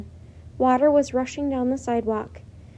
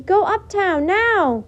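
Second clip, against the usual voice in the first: much higher, loud yell; 8 semitones higher, 7 dB louder, slower than usual. A narrator's reading. Girl yelling at the crowd to go uptown, loud. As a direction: fling the word at the crowd. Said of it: "yelled Annie".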